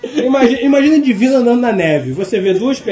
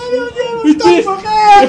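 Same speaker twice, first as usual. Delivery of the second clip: pânico imitado; 10 semitones higher, 3 dB louder than usual. Retold or imitated. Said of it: "eu agarrei no joelho e falei".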